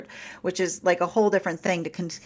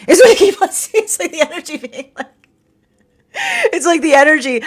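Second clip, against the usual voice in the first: laughing; 8 semitones higher, 7 dB louder than usual. Real, not acted. Laughing aside.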